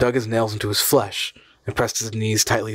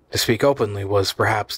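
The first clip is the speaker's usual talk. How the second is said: ordinary delivery, nothing marked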